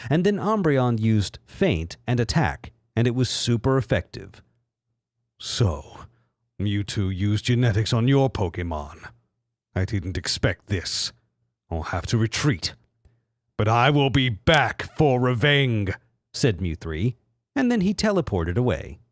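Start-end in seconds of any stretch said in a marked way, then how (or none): 5.4-15.9 lower, menacing mock villain. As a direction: announce doom like a cartoon baddie. Villain Mew three retreats.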